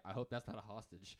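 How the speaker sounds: low, offhand retold remark; casual mock concern. Quoting the movie sergeant, casually offhand. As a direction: toss it off casually. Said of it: "He says it so casually".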